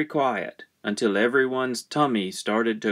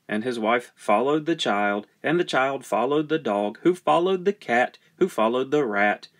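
usual speaking voice

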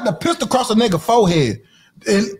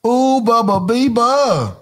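teasing singsong; 3 semitones higher, slower than usual. Acted.

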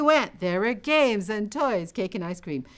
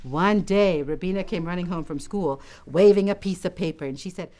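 plain reading voice

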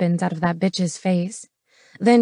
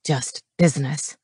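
lower, growled "Just business" with menace; 5 semitones lower than usual. A narrator's reading. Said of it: "Knox growled".

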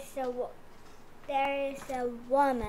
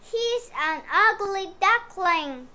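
1 loud mocking; 4 semitones higher, 10 dB louder than usual. A narrator's reading. Farm animals mock duckling.

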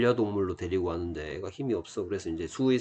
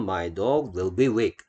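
very slow, word by word; 천천히 또박또박 읽어 주기; slow model reading; much slower than usual. Shown as a demonstration. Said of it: "teacher models the sentence". (아주 느린 시범 읽기)

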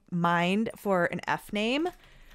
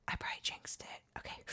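whisper; 16 dB quieter, faster than usual. Real, not acted. Fearing bad dice outcome.

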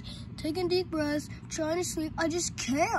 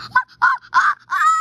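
short high shrieks in a row; loud repeated scream of fright; terrified screams; 15 dB louder than usual. Acted. Jump scare.

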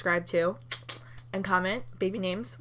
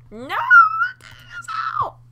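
very high squeaky cry; squealing plea; 11 dB louder, much faster than usual. Real, not acted. Player begging cops not to end party, high squeal. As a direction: shriek it in desperate begging.

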